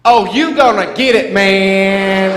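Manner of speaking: loud gloating shout; gleeful taunt. Retold or imitated. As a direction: crow it out at the bully. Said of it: "I'm like".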